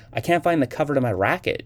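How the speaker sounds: a touch of a complaining moan, read aloud; whiny moan over a lost racket cover, lightly done. A narrator's reading. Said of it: "she moaned".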